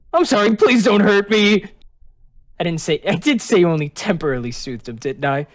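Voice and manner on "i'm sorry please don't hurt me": raised, frightened apology; acted timid begging; anxious pleading. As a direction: blurt a scared plea. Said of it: "the easily anxious angel".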